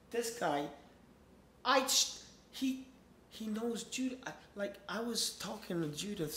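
high-pitched, worked-up venting; agitated rant. Real, not acted. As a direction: pour out the frustration, voice climbing. Agitated rant about the neighbour.

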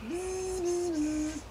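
quiet bee buzz, lightly voiced; soft buzzing. Bee buzzing softly at a bear. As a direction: hum like a shy bee.

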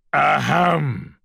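pointed "ahem"; reminding throat-clear. A narrator's reading. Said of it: "cleared his throat in a reminding sort of way".

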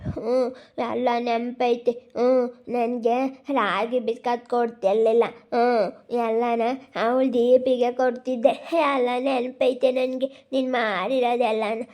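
put-on slow, halting voice of a hurt child; aggrieved boy. Boy lists stepmother's past cruelty, slow and aggrieved. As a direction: recount old wrongs haltingly, each one nursed.

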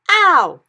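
loud high ow of pain; pained yell. Acted. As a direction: yelp out in pain. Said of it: "yelled the B F G".

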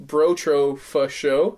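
each syllable spelled out slowly; slow dictation. Shown as a demonstration. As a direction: say it slowly for listeners to copy. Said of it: "at, at sign".

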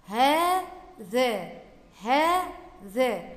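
هذا with a very drawn-out "aa" after the h; long alif in هذا. Shown as a demonstration. Teacher showing pupils the unwritten alif, هذا stretched out. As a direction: stretch هذا slowly, "haaa-dhaa". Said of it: "بنطق بعد الهاء ألف ولا تكتب".

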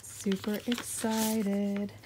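super slow, savored super excited; drawn-out excitement. Real, not acted. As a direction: stretch every word out with delight. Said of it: "I'm super excited about this one".

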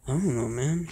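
normal talking voice

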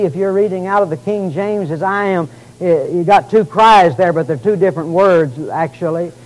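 ordinary talk, nothing marked

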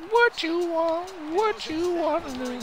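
high-pitched, slowed mocking question. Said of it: "I'm saying".

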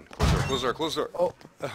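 loud urgent repeated command; urgent yelling. Real, not acted. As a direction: yell it at your teammate, fast. Urgent call to teammate.

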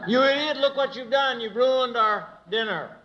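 put-on high-pitched scolding of a child; harsh scolding. Shown as a demonstration. Parent scolding son over ruined dinner, harsh high scold. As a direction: tear into the boy for ruining dinner. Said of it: "Or graciously, firmly take him aside".

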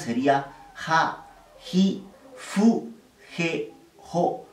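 ja, ji, fu, je, jo said slowly one by one; syllable drill. Shown as a demonstration. Teacher reciting the H row: slow drill. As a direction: spell out ja, ji, fu, je, jo clearly. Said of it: "La línea de sonidos de la H".

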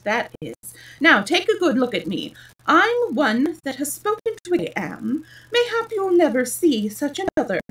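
lightly voiced pompous egg, high and loud; pompous Humpty Dumpty. A narrator's reading. Humpty Dumpty offering Alice his hand, self-important. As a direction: show off grandly with swooping pitch.

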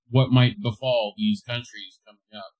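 ordinary talk

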